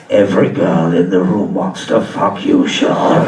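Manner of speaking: very low, rasping Star Wars emperor; evil emperor voice. Retold or imitated. Inner voice on cocaine as the evil emperor, deep and menacing. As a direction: growl it like a sinister old emperor. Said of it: "that evil emperor guy from the Star Wars".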